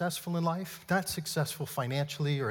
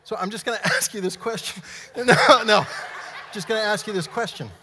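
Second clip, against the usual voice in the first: winded, higher voice breaking into laughter; amused and puffing, pitched up; out of breath; 6 semitones higher, 8 dB louder than usual. Real, not acted. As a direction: try to carry on through breathless laughs. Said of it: "I'm out of breath right now".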